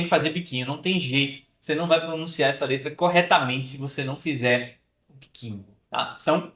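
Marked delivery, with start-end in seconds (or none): none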